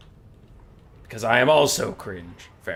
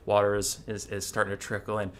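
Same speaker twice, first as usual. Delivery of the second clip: normal talking voice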